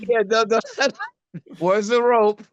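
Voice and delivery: high, laughing dark joke; mock dread. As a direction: laugh through the grim punchline. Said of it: "as soon as I hear that Southern accent".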